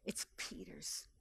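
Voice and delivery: hushed emphatic whisper; whispered, tender aside. Acted. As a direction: breathe it out like a secret.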